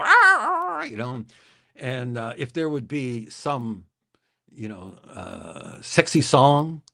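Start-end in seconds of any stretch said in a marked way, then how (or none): 0.0-0.8 high imitated rooster cry; rooster squawk. DJ voicing his pet rooster Pete, high squawk. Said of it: "and Pete would go".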